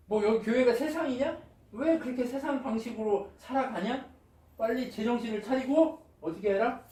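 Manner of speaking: loud, high scolding questions; acted rebuke. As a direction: scold the congregation as an exasperated apostle would. Paul scolding the church, acted. (언성을 높여 꾸짖듯이)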